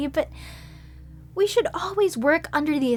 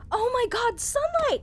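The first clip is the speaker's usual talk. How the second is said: thrilled discovery; 10 semitones higher than usual. Acted. Discovers sunlight.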